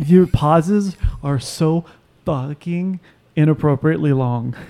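long gaps before "fucking" and "inappropriately"; deliberately halting, slow delivery of "so fucking inappropriately long"; exaggerated pauses. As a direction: stretch "so" and stop before "fucking". Mocks long pauses by using them.

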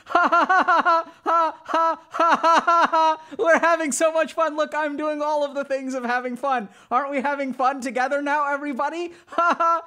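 high, loud forced ha-ha cackling; non-empathic laughter. Shown as a demonstration. Demo for viewers of forced fun, loud high fake laughing. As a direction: push the fun, trying way too hard. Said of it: "non-empathic laughter".

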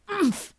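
high grunt on impact; short winded grunt of pain; painful oomph. A narrator's reading. Grover crashes into a stone bear, painful grunt. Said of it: "with a painful".

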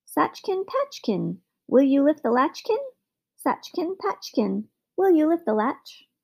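high, ringing little magic man; thin, clear voice. A narrator's reading. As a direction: lilt it high like a silver bell. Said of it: "thin and clear like the sound of a silver bell".

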